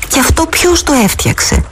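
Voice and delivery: insistent. Acted. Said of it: "ρώτησε ξανά επίμονα".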